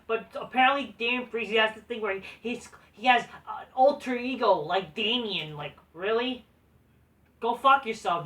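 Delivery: ordinary delivery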